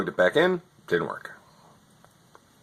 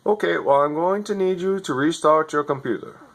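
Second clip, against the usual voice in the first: higher, scripted helpdesk patter; overseas tech support voice; 6 semitones higher than usual. Retold or imitated. Overseas agent reading his script to a customer, lightly mocking. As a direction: read the script like a bored call-centre agent. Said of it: "You're not even in America".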